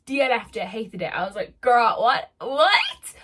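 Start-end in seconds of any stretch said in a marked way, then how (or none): 1.6-3.1 loud, incredulous outburst, rising on the repeat; hammed-up shocked "what"; dramatic disbelief. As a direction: react with over-the-top disbelief. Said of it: "I'm just dramatic again".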